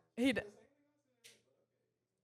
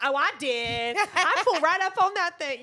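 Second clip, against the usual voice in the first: higher, louder carefree brag; breezy excited friend; 6 semitones higher, 8 dB louder than usual. Retold or imitated. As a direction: toss it off like it's nothing. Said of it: "Angelina was like".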